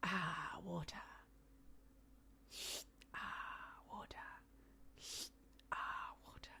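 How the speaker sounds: breathy whispered ah, water, barely voiced; mother mimicking her daughter's tiny whisper; imitating toddler's whisper. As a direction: whisper it like a little kid pretending to drink. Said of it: "dump it out and go".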